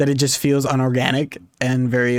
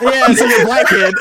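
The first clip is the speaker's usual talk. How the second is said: high-pitched laugh through the words; squealing with laughter; laughing quote; 10 semitones higher, 6 dB louder than usual. Retold or imitated. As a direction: crack up while repeating the line.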